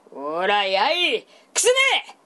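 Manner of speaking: put-on old monk's sudden yell; shout. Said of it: "和尚様が大声出した".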